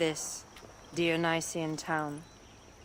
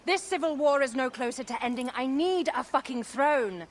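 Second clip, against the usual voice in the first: angry outburst; 6 semitones higher than usual. Acted. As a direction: push back hard, furious. Queen angrily rejects rest.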